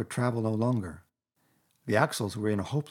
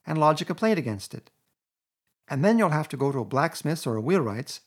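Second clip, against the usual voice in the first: 1.tags: bossy Rat; 6 semitones higher, 4 dB louder than usual. A narrator's reading. Rat lecturing Toad.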